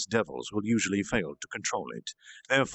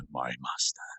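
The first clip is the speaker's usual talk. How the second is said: reverent whisper, much lower; 10 semitones lower, 5 dB quieter than usual. Acted. Demon kneeling to his master, whispered softly. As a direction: breathe it so as not to disturb. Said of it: "make sure he doesn't disturb".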